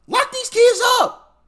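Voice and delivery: high, loud and slow outburst; outraged cry. Real, not acted. Vlogger venting at kids robbing stores, outraged loud cry. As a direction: demand it at the top of your voice.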